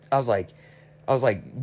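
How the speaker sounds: ordinary delivery, nothing marked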